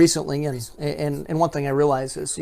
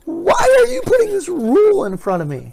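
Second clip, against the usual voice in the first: put-on whiny child voice; 5 dB louder than usual. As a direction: complain like an upset little kid. Voicing toddler defying rule.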